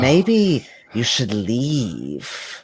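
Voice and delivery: very slow, drawn-out serpent voice; serpentine voice. Acted. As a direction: stretch each word like a snake in someone's head. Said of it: "you hear this almost serpentine voice".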